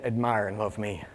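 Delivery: very low and soft; intimate, hushed voicing of Jesus; quiet appeal. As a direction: drop to a near murmur. Preacher voices Jesus's quiet appeal.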